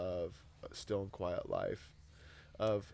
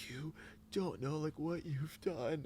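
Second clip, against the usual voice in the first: mild tearful quote; 7 semitones higher, slower than usual. Retold or imitated. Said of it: "crying their eyes out and saying".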